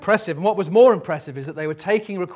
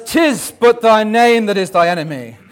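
hammed-up Juliet; 2 semitones higher, 5 dB louder than usual. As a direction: overplay Juliet for laughs. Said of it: "She says".

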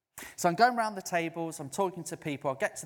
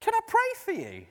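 a much higher, louder, unsure ask; an embarrassed offer to pray for a stranger in the pub; sheepish question; 6 dB louder, faster than usual. Retold or imitated. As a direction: pop the question like an awkward idiot. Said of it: "feeling like an absolute idiot".

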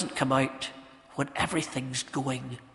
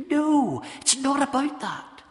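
high, loud drawn-out NO; insistent, high-pitched denial; emphatic rebuttal; 10 semitones higher, 6 dB louder than usual. Acted. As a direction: cut the objection off with a ringing no. Preacher answers voiced objection.

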